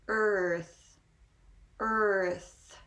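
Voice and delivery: "earth" drawn out with a full R into TH; vowel then R. Shown as a demonstration. Teacher repeating the word for learners, "earth" slowly sounded out. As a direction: hold the R in "earth" before a clear TH. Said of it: "and then we follow with the R".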